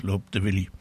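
usual speaking voice

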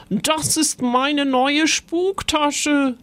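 high, drawn-out indignation; aghast complaint. A narrator's reading. Ghost mother over her ant-filled bag, high indignant complaint. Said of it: "starrte fassungslos".

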